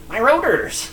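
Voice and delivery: high mock whine of my rotors; imitating Aiden. Friend mimics Aiden griping about his rotors, high and whiny. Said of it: "That's how Aiden says it".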